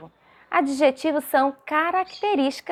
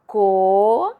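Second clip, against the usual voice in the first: "co" alone, loud and held; teacher's loud, stretched "co"; isolated syllable; 4 dB louder than usual. Shown as a demonstration. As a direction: sound out "co" as a long single syllable. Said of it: "a professora já separou falando".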